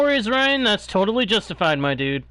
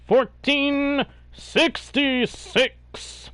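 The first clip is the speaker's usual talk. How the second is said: bingo caller; much slower than usual. Pretend. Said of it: "I sounded like a bingo caller?".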